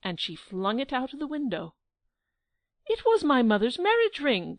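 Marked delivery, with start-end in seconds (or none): 2.9-4.6 a raised, louder outcry over the lost ring; crying out. Acted. Prince crying out to Angelica about his mother's ring, loud dismay. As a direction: Giglio crying out in dismay, pitched up. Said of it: "cried Giglio".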